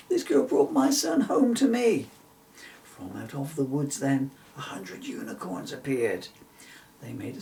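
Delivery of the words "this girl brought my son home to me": calling out. Acted. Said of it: "Bill's mother voice called out".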